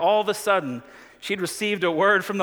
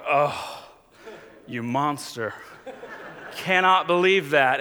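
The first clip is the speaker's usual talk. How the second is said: scornful gasp at the offender; mock disdain; 4 semitones lower than usual. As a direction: look down on them, tutting. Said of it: "we're like".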